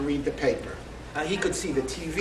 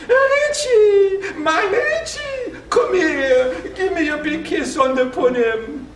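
very high, loud aunt's screech, slow on my Richie; imitating a screeching aunt greeting a little boy; 12 semitones higher, 5 dB louder, slower than usual. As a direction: screech it sky-high as if overjoyed. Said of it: "open her arms and screech".

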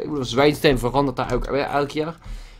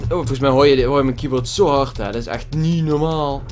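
ordinary delivery

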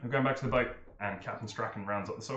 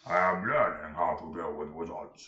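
laughing ogre; 5 semitones lower, 5 dB louder than usual. Acted.

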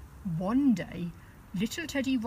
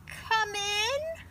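wolf putting on a high granny voice; the wolf pretending to be Grandma, high; high fake granny call; wolf faking Grandma; 13 semitones higher than usual. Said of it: "called the wolf".

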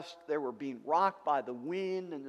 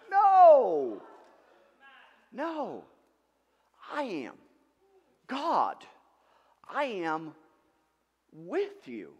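God's voice; 5 semitones higher, 9 dB louder, much slower than usual. Acted. God's voice answering.

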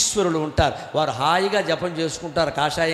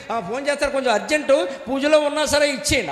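hammed-up call to the family, building to a shout; bossy devotee; 7 semitones higher than usual. Preacher mimics a devotee telling his wife to pass calls, mock shout.

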